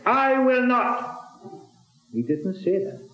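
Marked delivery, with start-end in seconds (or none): 0.1-1.2 high, slow mock-defiant "I will not"; high, drawn-out defiance; defiant refusal. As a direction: play the stubborn rebel, a little hammed. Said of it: "as some of us might have been prone to say".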